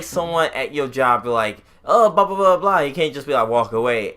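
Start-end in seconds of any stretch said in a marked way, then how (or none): 1.9-2.8 loud, high-pitched babble; mock rambling. Someone bothering a worker on the job, mock rambling. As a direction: imitate someone yammering on, raised and louder. Imitating a rambling person at work.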